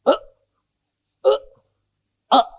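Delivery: short, separated imitated gasps; three dying gasps. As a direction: act out three last snatched breaths. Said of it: "Cuma tarik napas tiga kali". (tiruan tarikan napas terakhir)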